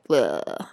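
disgusted bleh. Retold or imitated. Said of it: "you really are like".

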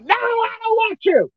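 high-pitched acted rejection; defiant refusal. Acted refusal of Israel to God.

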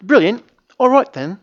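high, lilting mock-British; sing-songy British accent. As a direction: put on a chirpy, singing British accent. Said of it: "some of them are very sing-songy".

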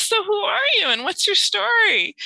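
high, slow and friendly questioning; curious neighbor. Retold or imitated. New neighbor making tea, asking her story, high and slow. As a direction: draw her out gently in a lifted voice.

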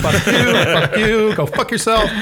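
Chevy Chase rant. Retold or imitated. Man remembering a movie meltdown, high hammed-up ranting. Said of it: "Chevy Chase just like".

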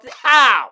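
loud pow; punch sound. Retold or imitated. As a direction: shout the punch.

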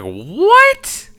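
very high, drawn-out rising what; stunned, stretch it out; astonished what. Retold or imitated. Hearing she was called a ferret, shrill astonished what. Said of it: "I was like".

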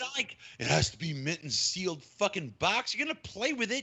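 high, incredulous dismissive rant; exasperated. Real, not acted. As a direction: scoff at the fuss. Exasperated rant about collectors.

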